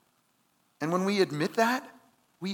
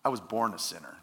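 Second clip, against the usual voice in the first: lower, self-assured boast; cocky answer; 8 semitones lower, faster than usual. Retold or imitated. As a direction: toss it off like you've got nothing to prove. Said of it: "He's like".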